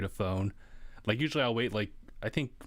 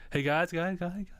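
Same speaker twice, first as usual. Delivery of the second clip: echo voice; 9 semitones higher than usual. Retold or imitated. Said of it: "Someone put in, like, an echo filter".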